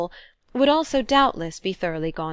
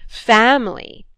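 louder mocking jeer on family; 4 semitones higher, 8 dB louder, slower than usual. A narrator's reading. Brother scoffing at his sister about family, loud jeer. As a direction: throw the word back with scorn. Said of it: "he jeered".

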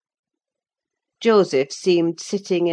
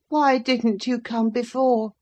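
put-on peevish sickly boy; 7 semitones higher than usual. A narrator's reading. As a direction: whine at the servant who ignores you. Linton reproaches Catherine.

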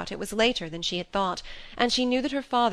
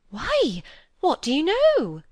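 high, louder startled question; astonished, eager query; agog; 9 semitones higher, 6 dB louder, slower than usual. A narrator's reading. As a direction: beg to hear the scandal. Phoebe agog for the scandal.

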